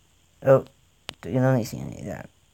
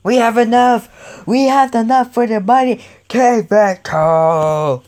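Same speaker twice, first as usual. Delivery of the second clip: much higher and louder, slow, triumphant cheering; ecstatic shout; 10 semitones higher, 10 dB louder, slower than usual. Real, not acted. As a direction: yell it out like you just won. Thrilled at affording the tank.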